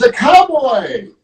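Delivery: high, stretched-out excited cry; story-time scream. Acted. Storyteller voices Elephant spotting the cowboy, screaming in play. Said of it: "And he screams".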